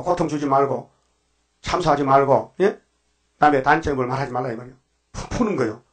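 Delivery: normal talking voice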